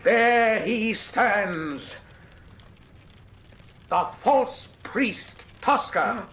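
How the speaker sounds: very slow, weighty denunciation; slow accusation. Acted. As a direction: drop each word like a verdict. Holmes slowly unmasks the killer.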